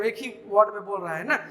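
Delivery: ordinary talk, nothing marked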